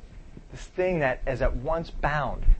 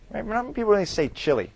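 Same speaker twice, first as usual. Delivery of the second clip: ordinary delivery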